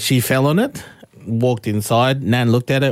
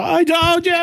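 high shouted gloat; triumphant shout. Retold or imitated. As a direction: yell it up high to rub it in. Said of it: "You hear me go".

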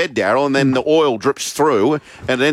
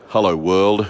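low, measured sample line; deep voice demo; 6 semitones lower, slower than usual. Shown as a demonstration. Host requesting a caller's hello world, deep. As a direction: lower the voice to show him the line. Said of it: "can you just give us a".

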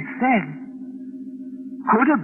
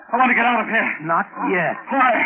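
ordinary delivery

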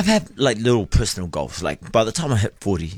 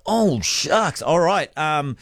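higher-pitched mock dismay; surprised high exclamation; 7 semitones higher, slower than usual. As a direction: squeak out the surprise. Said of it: "So I'm like".

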